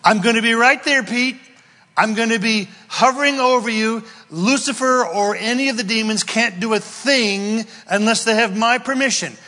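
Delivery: God reassuring Peter, shouting; impassioned shout. Acted. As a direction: preach God's promise at full force. Preacher voices God to Peter.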